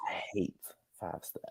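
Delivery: very soft and low; hushed confession. Real, not acted. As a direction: drop right down under the other speaker. Deadpan repeat of the confession.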